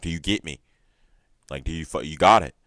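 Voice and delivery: loud threatening question; menacing demand. Retold or imitated. Connell threatening her brother, in a loud menacing demand. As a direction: get in his face and make him answer. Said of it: "and he's like".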